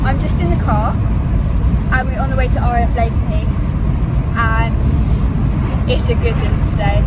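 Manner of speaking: shouting over wind. Real, not acted. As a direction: speak up over wind noise. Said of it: "I hope you can hear me over the wind".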